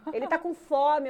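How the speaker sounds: high, loud nagging; imitated insistent mother. Grandmother tells showering mother baby is hungry, loud and high. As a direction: insist loudly like a fretting grandmother.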